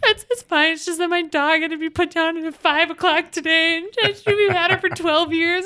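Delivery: a high put-on voice, a mild mock of a crying girl; imitated crying girl. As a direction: lightly imitate a girl holding back tears. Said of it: "she's crying".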